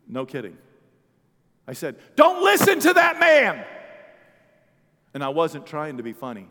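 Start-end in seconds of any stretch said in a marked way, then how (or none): 2.1-3.7 high, slow, loud warning; forceful shout. Retold or imitated. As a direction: override the other preacher with a yell. Pastor retells countering the clergyman.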